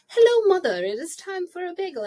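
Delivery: high, loud, formal mock voice; playing the daughter's weird greeting; daughter's silly voice. Daughter announcing bagel time to mom, high mock formal voice. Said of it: "She's like".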